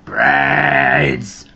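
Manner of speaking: low, groaning zombie moan. Acted. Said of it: "a Dead Rising two one with zombie sounds".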